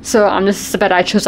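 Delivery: usual speaking voice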